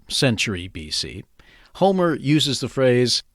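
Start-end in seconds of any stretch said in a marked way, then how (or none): none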